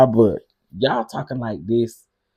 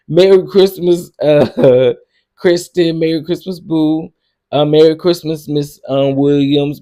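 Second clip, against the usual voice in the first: louder, higher Merry Christmas calls; loud merry greetings; 5 semitones higher, 8 dB louder than usual. Real, not acted. Greeting each live viewer by name with cheerful calls. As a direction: shout out each caller by name.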